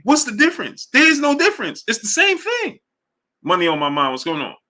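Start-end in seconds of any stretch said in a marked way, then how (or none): 0.0-2.8 exasperated at chat's logic; incredulous. Real, not acted. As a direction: pitch shooting far higher, disbelieving. Exasperated peak of argument.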